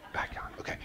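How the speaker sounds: breathy whispered aside; real whispered self-calming. Real, not acted. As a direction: mutter it to yourself, voiceless. Calming himself after outburst.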